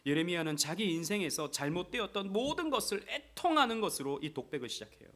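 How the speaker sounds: ordinary delivery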